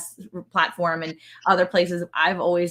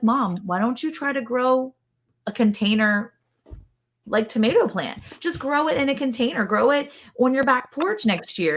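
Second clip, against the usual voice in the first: higher-pitched coaxing of a parent; retold eager suggestion, higher voice; encouraging urging; 6 semitones higher than usual. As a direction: nudge mom along, pitched up. Retold urging of her mother.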